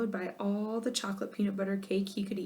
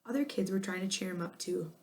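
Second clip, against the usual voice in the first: ordinary delivery, nothing marked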